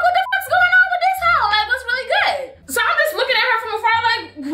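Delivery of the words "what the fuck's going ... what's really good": baffled outburst. Retold or imitated. Narrator reliving the girl kicking at her, high and incredulous. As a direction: shriek your disbelief, then laugh it off. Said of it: "I'm like".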